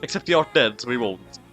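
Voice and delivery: ordinary talk, nothing marked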